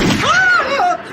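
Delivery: very high, loud scream; loud yelp. Acted. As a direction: cry out in sudden alarm. Cut off while pleading to explain.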